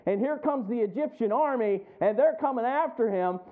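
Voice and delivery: ordinary talk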